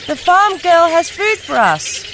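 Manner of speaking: calling ducks. Acted. Older ducks calling the others to the farm girl's food, high and loud. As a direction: call the flock to the food.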